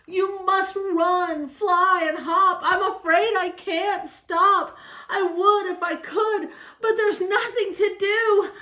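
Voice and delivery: rising panic, acted out; urgent warning. A narrator's reading. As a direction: warn everyone off as it builds helplessly. Elephant warns before sneezing.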